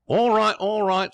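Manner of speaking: slow, high, loud waiter's call. Acted. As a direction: holler it across the room to a customer. Waiter answers a calling customer.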